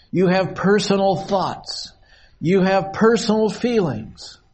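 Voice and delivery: preacher hammering home "personal"; stress on personal. Real, not acted. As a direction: lean on the word "personal" every line. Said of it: "I want to stress the words personal".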